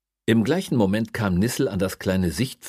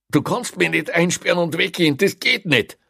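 high, raised, pleading voice; distraught protest; 11 semitones higher, 5 dB louder than usual. A narrator's reading. Trembling prisoner begging not to be locked in, distraught. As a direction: plead desperately, pitched high.

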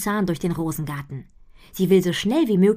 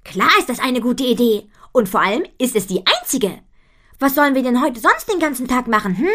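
eager fairy voice; 7 semitones higher, 7 dB louder than usual. Acted. Ponyfee voiced.

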